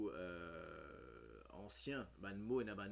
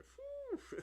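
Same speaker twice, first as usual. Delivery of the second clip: squeaky laugh. Real, not acted. Laughing outburst mid-explanation.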